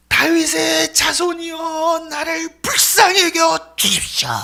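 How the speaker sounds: slow, high crying out for mercy; desperate cry. Acted. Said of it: "가장 큰 소리로 부르는 거예요". (자비를 구하며 천천히 높게 외치듯)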